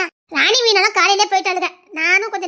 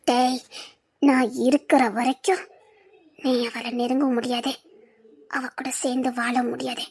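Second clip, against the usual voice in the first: menacing villain; 6 semitones lower than usual. Acted. Villain vows vengeance.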